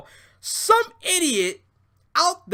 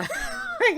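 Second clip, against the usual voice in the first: high burst of laughter; real laugh. Real, not acted. Cracking himself up over the idea, high laugh. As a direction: laugh at the joke.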